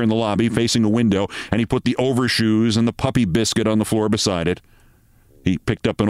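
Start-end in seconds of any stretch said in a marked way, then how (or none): none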